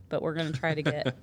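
normal talking voice

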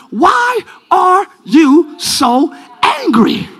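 very slow, word-by-word preaching; dramatic scripture reading. Acted. The Lord questioning Cain, slow dramatic preaching. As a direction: space out each word of God's question. Reads scripture as God's words.

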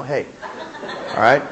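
ordinary talk

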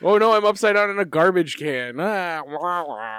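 hammed-up goofy wail, fading at the end; Bulk and Skull. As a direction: ham up a flailing sitcom goon. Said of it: "a Bulk and Skull trying to fight".

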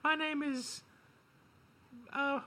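squeaky mock caller; high-pitched caller voice. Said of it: "someone calls in".

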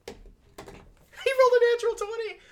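very high, loud and slow; astonished announcement. Real, not acted. Game master announcing a natural twenty, high astonished cry. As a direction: stretch it out high in disbelief. GM reacts to a top roll.

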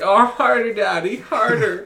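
high, loud mock moan; mock begging. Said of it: "I was like".

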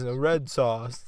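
slow, mock old Italian man's accent. Speaking down to Italians in an Italian accent. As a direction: lean into a jokey Italian accent. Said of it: "sounded like an old Italian guy".

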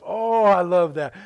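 slow, loud, fond delight; dad delighted. Retold or imitated. Old dad recalling Grandma's possum, slow loud delight. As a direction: savour it like a treat remembered. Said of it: "And so I said".

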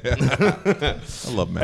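slow, soft laughing words. Real, not acted. Comedian laughing at his friend's bit, slow soft laugh. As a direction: laugh it out fondly. Laughing fondly after a quote.

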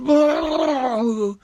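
long, high, loud wail of alarm; startled whoa. A narrator's reading. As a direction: cry out as if losing your balance. Lizards shaken in the tree.

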